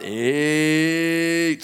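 loud, high, long-held number; slow-motion count. Retold or imitated. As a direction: stretch it out as a slowed-down referee. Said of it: "slows down to slow motion".

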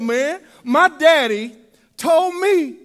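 ordinary talk, nothing marked

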